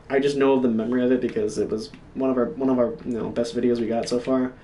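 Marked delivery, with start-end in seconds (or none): none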